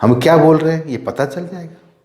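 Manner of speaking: normal talking voice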